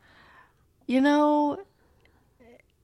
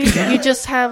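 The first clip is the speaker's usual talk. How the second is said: ordinary delivery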